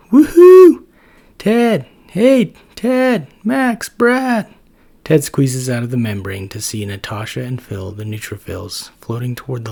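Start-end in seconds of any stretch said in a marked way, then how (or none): 0.1-4.5 very high, very loud cheerful calls; hollering across the street to friends; shouting from outside. Acted. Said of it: "Some cells shout outside".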